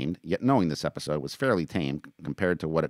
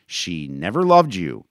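loud, slow accusation; angry outburst; 2 semitones higher, 8 dB louder, slower than usual. A narrator's reading. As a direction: finally let it out, loudly. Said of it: "Maori finally let it out".

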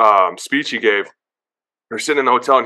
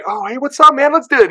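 much higher, louder, pumped-up answer; eager reply; 13 semitones higher, 4 dB louder than usual. Retold or imitated. New teammate answering Geno's welcome, pumped up and eager. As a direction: jump at the chance to join in.

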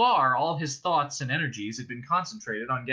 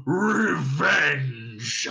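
louder scornful dragon snort; scornful dragon snort on Revenge!; 2 semitones higher, 5 dB louder than usual. Acted. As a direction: spit the word out with contempt. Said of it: "he snorted".

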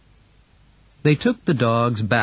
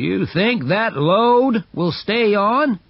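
doubtful onlooker; 9 semitones higher than usual. Acted. Onlooker voiced in story.

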